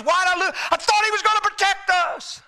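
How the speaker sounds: high, pressed protest of the bewildered; confused complaint. Acted. Grieving believer questioning God, confused high-pitched complaint. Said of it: "you're so confused you can't even pray".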